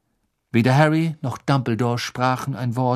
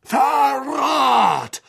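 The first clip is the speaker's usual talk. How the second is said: high shouted accusation; furious roar. A narrator's reading. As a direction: bellow it in outrage. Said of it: "brüllte er".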